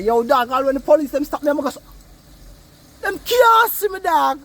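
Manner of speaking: lightly voiced cocky youth; street boast. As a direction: brag like the young hustler. Retelling his friend's street boast.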